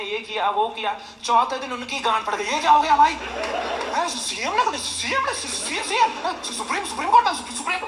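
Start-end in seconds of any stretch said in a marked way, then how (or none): 2.4-7.8 high, stammering hammed-up panic; mock panic. Comedian imitating a panicked friend stammering about the Supreme Court, hammed-up fear. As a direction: sputter it like a terrified friend.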